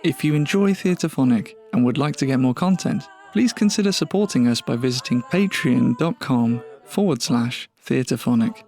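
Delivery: ordinary delivery, nothing marked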